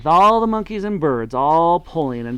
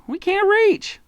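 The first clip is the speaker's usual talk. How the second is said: very high little voice; small creatures pleading; 4 dB louder, slower than usual. A narrator's reading. The monkeys tell Mugglewump, very high and plaintive. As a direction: squeak it up like a tiny monkey. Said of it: "they asked".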